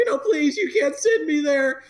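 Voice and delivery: put-on high pleading child voice; pleading kid. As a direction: beg like a panicked kid. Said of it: "he's like".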